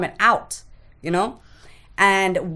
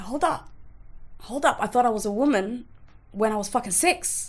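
retold baffled objection; 5 semitones higher, 4 dB quieter than usual. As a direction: question it in disbelief, pitched up. Retold baffled protest at mother's remark.

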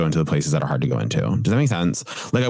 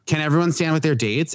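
bossy mom voice; 8 semitones higher than usual. Retold or imitated. Prom mom giving orders.

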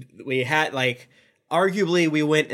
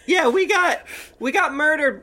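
laughing; 11 semitones higher than usual. Acted. Admits death laughing.

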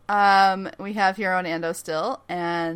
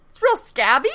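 normal talking voice

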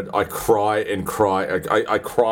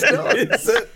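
laughing. Real, not acted. Laughing at the joke.

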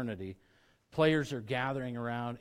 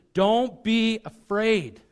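higher, louder and slow, stretching each word; loud urgent command; 10 semitones higher, 12 dB louder, much slower than usual. Shown as a demonstration. Preacher voicing Jesus urging Jairus, loud and slow. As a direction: call it out big and deliberate. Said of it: "He turns to him immediately, immediately says".

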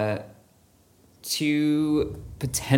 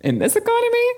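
sassy. Pretend. Mock dramatic quip.

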